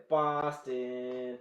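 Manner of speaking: slow, drawn-out mock Boston accent. Southerner trying the city's name, mock Boston accent. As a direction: stretch "Boston" in a put-on Boston accent. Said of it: "Oh, Boston accent".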